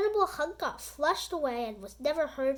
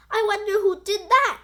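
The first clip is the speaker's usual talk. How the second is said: wondering fan; 7 semitones higher, 8 dB louder than usual. Acted. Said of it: "said another fan".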